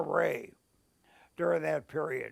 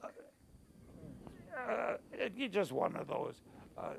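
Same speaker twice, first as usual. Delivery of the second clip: choked up; 11 dB quieter, faster than usual. Real, not acted. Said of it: "Forgive me for getting emotional".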